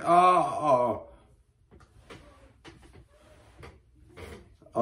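hammed-up moan. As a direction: ham up a fake moan. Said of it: "the chick with the strap-on's like".

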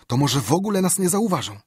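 high, loud scared voice; trying to stay calm but terrified; frightened pleading. Acted. Huck frightened, tries to calm.

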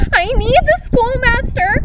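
high panicked yell. A narrator's reading. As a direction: shout it out in panic. Falling off ladder, yelling.

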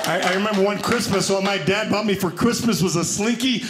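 usual speaking voice

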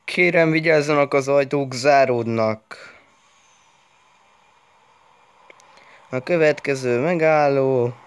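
high mock train announcement; metro announcer voice. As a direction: call the doors like the metro. Imitating metro door announcement. (metró bemondó hangja)

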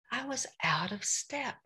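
a hushed aside, out of step; whispered admission. Real, not acted. Speaker confessing her failing to the congregation, in a whisper. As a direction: whisper it, almost to yourself.

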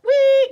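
high, loud squealing toot; imitated party blower. Shown as a demonstration. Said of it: "the noise it makes".

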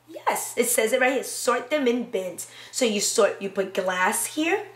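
normal talking voice

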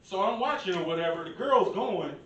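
usual speaking voice

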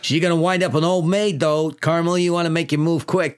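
New York boss teasing his detective; jokey cop boss voice; police chief. Acted. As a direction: needle him like a meddling precinct chief.

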